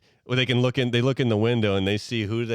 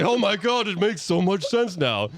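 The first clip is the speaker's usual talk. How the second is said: mock realization; 11 semitones higher than usual. Santa realizing.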